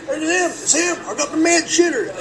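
put-on high, loud nagging old man voice; harping old man. Old man chasing him through the store: high loud harping. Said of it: "old man's still behind me harping".

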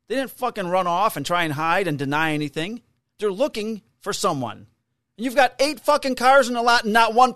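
high, loud furious rant, swearing; retold yelling. Cop yells at the business owner who wants him arrested, furious loud rant. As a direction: blow up at her, full volume. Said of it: "I yelled at her".

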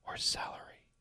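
whisper, fading to nothing. Acted. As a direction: let it trail away in a whisper. Dramatic recipe reading.

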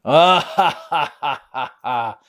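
high cackling villain laugh that fades away; bully laughing in triumph with the mask on. Acted. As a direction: gloat with a triumphant bad-guy laugh. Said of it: "started laughing".

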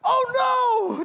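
high, loud wail of dismay; hammed-up drawn-out alarm; mock horrified cry. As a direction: cry out as the disaster dawns. Tomb realizes the resurrection.

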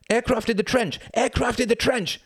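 high-pitched shouted warning; alarmed cry. A narrator's reading. German soldiers spot a plane in their trench, alarmed cry. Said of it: "they cried out".

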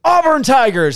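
high, loud rival chant; taunting shout. Retold or imitated. Cop baiting drunk Alabama fan, high loud taunt. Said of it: "And then I go".

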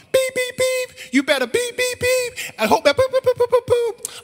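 high-pitched beep noises over curse words; rapid-fire falsetto beeps and boops; imitated bleeps. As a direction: squeal out mock censor beeps. Imitates bleeped-out road-rage curses.

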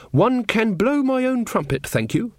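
high, indignant royal put-down; haughty. A narrator's reading. Queen turns down the fanfare, haughty. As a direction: cut the servant off grandly. Queen insists, pulling rank.

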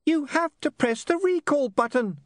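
high, snippy imp voice; tiny imp losing patience; impatient imp. Acted. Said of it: "said the imp impatiently".

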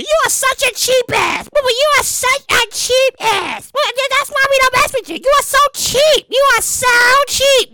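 high, slow nagging mother voice; mock mom. Son mimicking his mom calling him a cheap ass.

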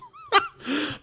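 bursting, high-pitched real laugh. Real, not acted. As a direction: let the laugh break out high. Laughs at friend's joke.

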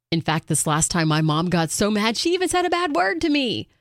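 ordinary talk

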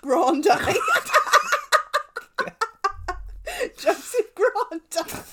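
Grande said slow and high as a grand surname, laughing; grand pronunciation. Pretend. Announcing a posh new surname, slowly and grandly. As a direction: Joseph Grande drawn out high and loud, then a laugh. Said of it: "my surname is pronounced".

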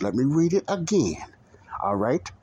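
usual speaking voice

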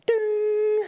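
high sustained "ding" like the cabin chime; ping out the seatbelt sign tone; imitated seatbelt chime.

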